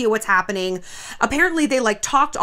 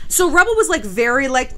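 ordinary delivery

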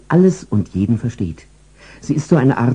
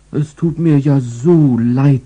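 pleading dog; 5 semitones higher, slower than usual. Acted. Dog's pleading look.

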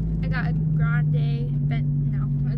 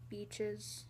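ordinary talk, nothing marked

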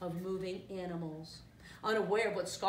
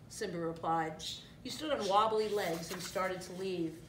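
plain reading voice

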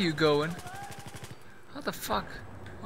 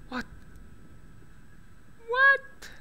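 can't believe what just happened; stunned disbelief; 4 dB quieter than usual. Real, not acted. Disbelief at game event.

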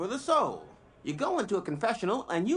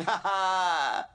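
amused laugh; 3 dB louder than usual. Acted. Narrator picturing God with a cappuccino, amused laugh. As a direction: open "ha ha" laugh.

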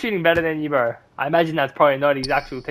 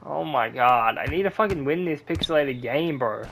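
normal talking voice

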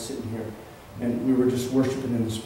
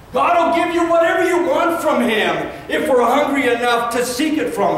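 preaching at full force; 12 semitones higher, 6 dB louder than usual. Acted. Pastor stirring hungry believers, preaching at full force. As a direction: fire them up and don't let go.

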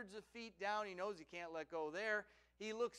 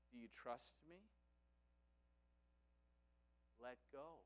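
hushed; 6 semitones lower, 17 dB quieter than usual. Acted. God answers Abraham's many questions in a hush. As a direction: answer quietly, leaving long pauses.

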